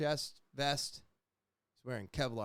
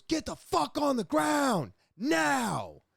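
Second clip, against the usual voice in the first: much higher, loud, slow shouted command; officer shouting order; 6 dB louder, slower than usual. Retold or imitated. Armed officer shouting at him to get down. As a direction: bark the order to hit the ground.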